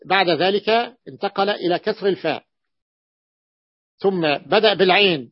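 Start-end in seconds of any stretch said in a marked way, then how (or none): none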